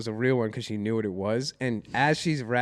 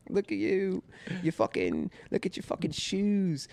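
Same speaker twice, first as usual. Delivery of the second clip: imitated Cockney British jeering; rough British-accent taunt; 5 semitones higher, 5 dB quieter than usual. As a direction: put on a terrible Cockney accent to mock someone. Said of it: "she was in a British accent".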